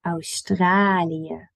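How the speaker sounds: Australië slowly, long au and a clear je at the end; slow Dutch Australië. Shown as a demonstration. Teacher modelling Australië slowly for learners. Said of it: "Pamper that really in there".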